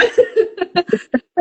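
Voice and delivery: light high laugh; real, high-pitched chuckle of amusement. Real, not acted. Psychologist enjoying the joke about birth, laughing.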